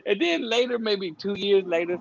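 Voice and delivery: ordinary delivery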